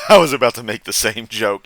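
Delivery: laughing loud through the words. Real, not acted. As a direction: laugh while saying it. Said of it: "he and I were thinking the same thing".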